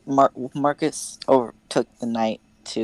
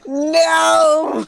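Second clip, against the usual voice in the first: a loud laughing no; laughing shout; 7 dB louder than usual. Real, not acted. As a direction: yell it through laughter. Laughing protest at the twist.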